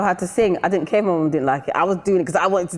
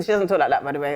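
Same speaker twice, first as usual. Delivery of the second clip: usual speaking voice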